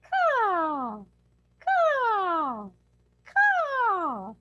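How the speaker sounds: high, loud "Caw" rising each time, throat open; demo caw sounds. Shown as a demonstration. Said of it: "So you wanna say".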